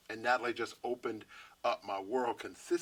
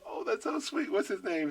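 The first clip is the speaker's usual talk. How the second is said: a much higher, sugary star voice; 9 semitones higher than usual. Retold or imitated. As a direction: coo it like a touched celebrity. [name] voiced, touched.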